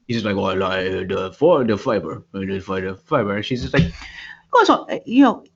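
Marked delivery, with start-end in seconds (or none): 0.4-3.3 put-on low, halting Russian accent; mock Russian worker. Foreign fiber worker explaining himself, low mock Russian accent. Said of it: "he's Russian, I think. He's very foreign". 3.8-5.4 put-on high, scolding English accent; mock English lady. English lady scolding workers about distance, high mock English accent. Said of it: "she's English, they always begin polite".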